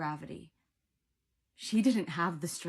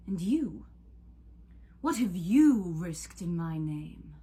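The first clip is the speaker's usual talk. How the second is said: taunting god Cupid; 2 semitones higher, 6 dB louder than usual. A narrator's reading. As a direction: lord it over a mortal, stretching key words.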